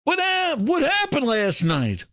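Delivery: normal talking voice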